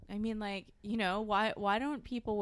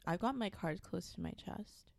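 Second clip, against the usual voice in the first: ordinary delivery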